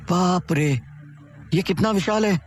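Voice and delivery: put-on a girl stunned by a giant ship; amazed. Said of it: "उसने आश्चर्य से कहा।".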